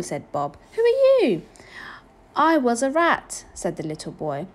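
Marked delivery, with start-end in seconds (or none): 0.7-1.4 loud, high astonished question; big startled question. A narrator's reading. Bob questions unexpected night visitor. 2.3-3.4 put-on high, slow, loud little-boy voice; earnest child, pitched up. A narrator's reading. Little boy's answer in story.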